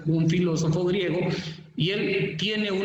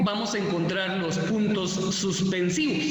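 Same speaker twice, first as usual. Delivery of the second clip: ordinary delivery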